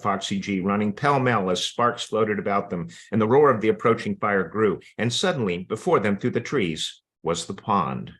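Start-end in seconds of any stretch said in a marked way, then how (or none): none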